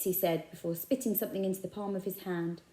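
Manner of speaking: ordinary delivery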